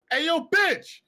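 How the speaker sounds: high, loud, abrupt holler; rude call-out. Acted. Joking about getting a woman's attention at a restaurant, rude call-out. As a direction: drop the politeness and holler it.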